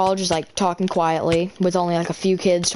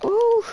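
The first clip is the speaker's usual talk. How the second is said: real high, startled ooh; high-pitched "ooh". Real, not acted. Player reacting to game danger, high startled ooh.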